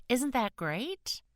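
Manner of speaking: high, slow, beaming question; enthusiastic. Acted. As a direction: invite him to share her joy. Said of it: "she said enthusiastically".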